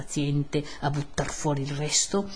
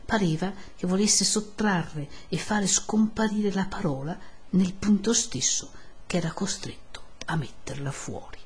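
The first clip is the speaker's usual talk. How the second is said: ordinary delivery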